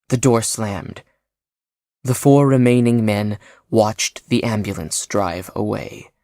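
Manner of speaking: ordinary delivery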